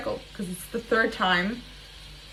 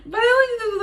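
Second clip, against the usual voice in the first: laughing squeal; 13 semitones higher, 7 dB louder, much faster than usual. Real, not acted.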